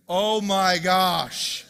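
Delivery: loud, drawn-out exasperated outburst; going off. Acted. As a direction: explode in hammed-up indignation. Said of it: "go off".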